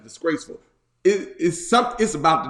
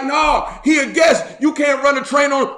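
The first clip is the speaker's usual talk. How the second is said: high, raised-voice refusal; indignant objection, pitched up; protesting shout; 6 semitones higher than usual. Acted. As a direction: plead against the mob in a raised voice. Old man refuses the mob.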